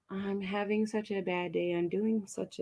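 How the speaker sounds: gloomy self-talk. Shown as a demonstration. Coach showing listeners defeated self-talk, mopey and soft. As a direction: sink into a bad day. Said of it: "if we attract".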